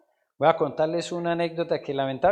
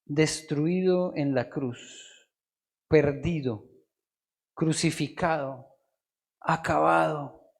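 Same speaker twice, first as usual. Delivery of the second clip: somber; 4 semitones lower, 3 dB quieter, slower than usual. Acted. Lists defeated image of Christ.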